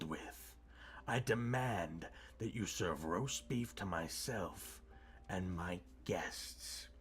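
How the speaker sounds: low, slow menace sinking to a whisper; menacing villain. Acted. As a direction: loom over the children and threaten. Villain threatens the orphans.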